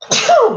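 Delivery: sudden loud sneeze; sneezing. Real, not acted. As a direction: let out a real sneeze. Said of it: "I'm gonna sneeze".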